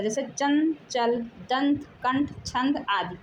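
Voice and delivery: चंचल, दंत, कंठ said slowly, nasal sound clear; careful anusvara demo; slow nasal examples. Shown as a demonstration. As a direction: spell out each nasal slowly. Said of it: "अर्धध्वनि अनुस्वार कहलाता है".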